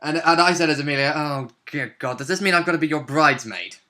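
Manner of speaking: ordinary talk, nothing marked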